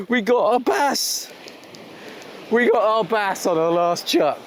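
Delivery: high, slow, jubilant cheer; excited. Real, not acted. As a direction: celebrate the catch in triumph. Celebrating catching the bass.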